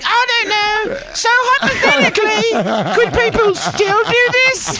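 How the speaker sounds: very high mock-innocent falsetto; imitating Kelly. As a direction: ask coyly in a squeaky, drawn-out falsetto. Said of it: "Right when she's like".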